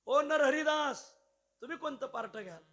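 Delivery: hailing someone from afar; calling out. Retold or imitated. Organizer calls out to Narhari.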